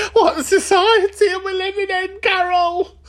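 high, hammed-up voice of an outraged nan; mock gossip voice. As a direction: squeal it like a scandalised old lady chatting to Carol. Mock outraged aside to imaginary Carol.

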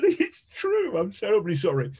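high-pitched owning up, then easing down; mock apologetic confession. Journalist owns up to the accusation, mock-contrite high voice. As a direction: play a hammed-up apology, starting very high. Acted sheepish confession in story.

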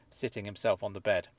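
usual speaking voice

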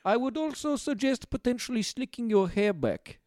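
lightly voiced Soviet dictator with a vague accent; Joseph Stalin. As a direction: play an accented dictator as fashion expert. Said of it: "Joseph Stalin here".

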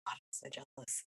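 very soft, voiceless sigh of longing; whispered envy. Retold or imitated. Homesick viewer watching Kmart on TikTok, whispered envy. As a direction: whisper it to yourself. Retold reaction to TikTok video.

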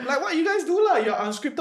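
high laughing Singlish quote; chirpy Singlish teasing, laughing. Retold or imitated. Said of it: "It's like".

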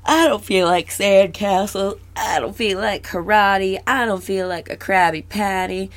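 slow, sulky mope; moping. Retold or imitated. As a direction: drag it out, sulking. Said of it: "She's just like".

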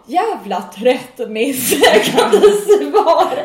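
laughing mock yell. Annoyed at Nisse for not replying, a slow laughing mock yell. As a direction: drag it out, half laughing. Retold angry SMS to Nisse.

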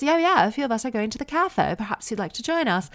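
high imitated posh transatlantic twang; posh American, British twang. Mom invites her friend to the cafe, posh British twang. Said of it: "posh American with that British twang".

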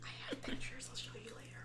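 hushed unvoiced whisper. Real, not acted. To friends, hushing about pet photos, whispered. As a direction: whisper it like a secret.

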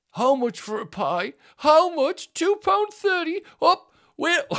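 put-on high, loud, slow outraged old woman voice; shrill old woman. Old woman at the kiosk, outraged at pie prices, high and loud. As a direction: squawk the price like a scandalised granny. Mimicking shocked older woman.

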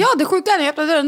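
ordinary talk

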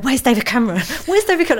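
frantic, half-laughing calling for David Cameron; mock-frantic search through the canteen; mad woman. Hunting the canteen for David Cameron, frantic mock calling. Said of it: "Like some mad woman".

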